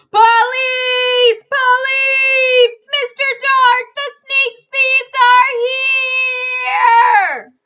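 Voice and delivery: full-throated high scream for the police; terrifying shout. A narrator's reading. Stanley screaming for police to frighten the thieves. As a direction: yell it as loud as you can to scare them. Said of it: "shouted in the loudest, most terrifying voice".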